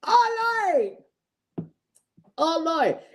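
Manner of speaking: high, drawn-out plea to God; retold high-pitched exclamation of being convicted; mock wail. Retells crying out to God.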